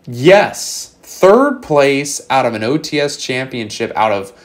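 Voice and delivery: booming, slow hype-man announcement; hype announcer. Acted. Hyping the tournament result like announcer.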